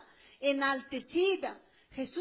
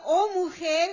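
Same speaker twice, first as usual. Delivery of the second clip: with mercy; 3 semitones higher, 8 dB louder, slower than usual. Acted. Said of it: "está hablando con misericordia".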